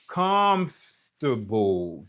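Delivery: very slow comfortable, long COMF; stress on first syllable. Shown as a demonstration. Teacher showing where the accent falls, first-syllable stress. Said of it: "premye silab lan".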